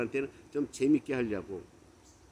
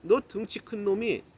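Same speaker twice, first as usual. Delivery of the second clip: higher, heated scolding; re-enacted indignant rebuke, pitch raised; retold angry outburst; 5 semitones higher, 4 dB louder than usual. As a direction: tell off a friend, building louder. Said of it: "그러니까 이제 제가 화를 낸 거예요".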